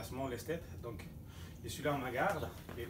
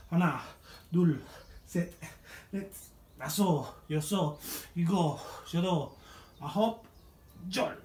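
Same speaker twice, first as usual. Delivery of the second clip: shouted count; 5 semitones higher, 7 dB louder, much slower than usual. Acted. Final set counted louder.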